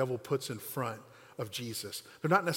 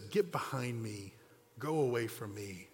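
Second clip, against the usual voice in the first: soft, slow, grave rebuke; a hushed, slow, heavy rebuke to Peter; devastating rebuke; 4 semitones lower, 7 dB quieter, slower than usual. Acted. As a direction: say it quietly, slowly and let it cut. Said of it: "says something devastating back".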